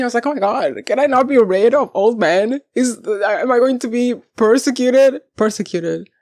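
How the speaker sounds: ordinary talk, nothing marked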